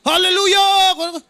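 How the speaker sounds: high, slow shouted hallelujah; mock shout. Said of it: "Sumisigaw na nga yung".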